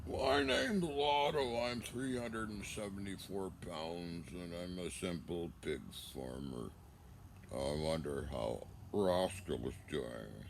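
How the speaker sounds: slow, low drawling pig farmer voice; imitating Otto. Brothers imitating Otto the pig farmer, slow and low. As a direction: drag it out slow like a simple farmer. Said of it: "imitating people like Otto Ed Roscoe".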